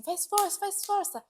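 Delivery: high, loud command to push; urging push. Retold or imitated. Midwife urges mother in labour, loud high push command. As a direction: shout at her to push hard. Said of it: "Aí ela falou assim".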